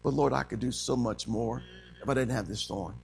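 low, soft inward plea; hushed, low imagined prayer; Paul's private thought. Acted. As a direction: think it quietly to the Lord. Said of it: "Do you think Paul thought to himself".

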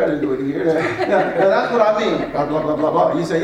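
usual speaking voice

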